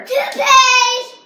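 loud disgusted yell; disgusted shout. Real, not acted. Gross flavor revealed.

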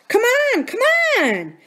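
high, loud call from afar; shout. A narrator's reading. Buki shouts from up ahead for the others to hurry, loud call. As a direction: yell to hurry them along. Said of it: "Buki shouted from up ahead".

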